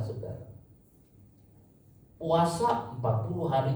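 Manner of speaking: ordinary delivery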